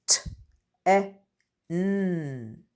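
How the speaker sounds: each letter sound said separately, with pauses between. Shown as a demonstration. Teacher to pupils, sounding out a word letter by letter. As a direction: split the word into its single sounds for the class. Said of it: "listen carefully as I sound out".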